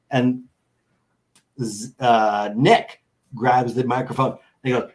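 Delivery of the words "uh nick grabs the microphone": ordinary talk, nothing marked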